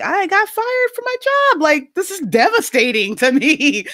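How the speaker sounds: very high, wailing mock sob with a laugh breaking through; retold crying. Answering her mom's question, a high, half-laughing retold sob. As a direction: play your younger self sobbing, half laughing at it. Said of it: "Why are you crying?".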